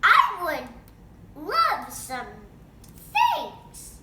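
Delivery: high, loud call. Acted. Bean calls out loudly.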